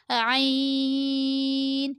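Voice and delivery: "عین" squeezed from the throat, held long. Shown as a demonstration. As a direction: constrict the throat and stretch "عین" on one tone. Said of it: "حلق سے نکالیے گا".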